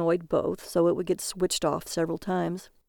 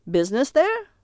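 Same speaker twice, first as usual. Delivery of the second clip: high, curious cab driver; cabbie voice; 6 semitones higher, 4 dB louder than usual. Acted. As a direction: ask like a nosy cabbie.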